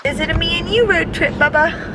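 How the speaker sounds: usual speaking voice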